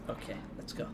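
hushed, barely voiced whisper. Real, not acted. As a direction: whisper it to a companion. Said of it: "Why did I whisper that time?".

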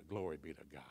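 soft breathy whisper; whispered praise. Real, not acted. Preacher whispering praise after the climax. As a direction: breathe it reverently.

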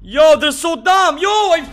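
high, loud, drawn-out outrage; real frustrated shout, pitch climbing; exasperated yelling. Real, not acted. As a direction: yell in disbelief at useless teammates. Rages at teammates' play.

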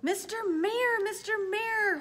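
high, loud urgent hail; calling out. A narrator's reading. Horton calling down to the mayor, urgent high shout. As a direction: call out as if to someone far below. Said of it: "Horton called".

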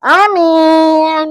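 high, loud drawn-out amen. Real, not acted. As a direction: sing out the amen. Loud amen after prayer. (amin lantang)

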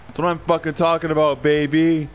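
loud celebratory yell; celebrating. Real, not acted. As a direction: crow over the goal. Celebrating a goal.

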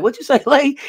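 high, loud teasing call; hammed-up shout to Lay; mock teammate call. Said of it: "Be like".

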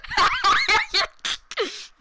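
loud, very high laughter; high cackling laugh. Real, not acted. Finding it funny with a friend, loud cackle.

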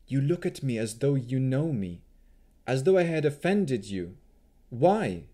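put-on 1 urgent young elf woman. A narrator's reading. Rowan confronts Rahadin.

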